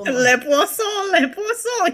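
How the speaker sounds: slow, hammed-up le poisson; mock French accent. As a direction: croon it like a cartoon French chef. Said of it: "make it croissant".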